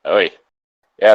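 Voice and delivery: ordinary delivery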